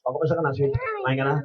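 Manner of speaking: relaxed sweet husband voice. Retold or imitated. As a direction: ask tenderly, easy and cool. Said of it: "no, relax".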